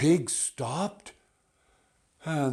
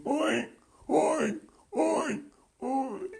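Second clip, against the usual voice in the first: very high squealing oinks; crying pig oinks. Acted. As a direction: squeal oinks like a sobbing piglet. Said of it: "He was crying".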